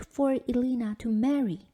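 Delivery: ordinary delivery, nothing marked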